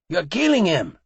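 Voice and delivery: loud, high scream. A narrator's reading. Barber screaming at the thugs beating his friend, loud and high. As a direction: scream in horror. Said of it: "the other barber screamed".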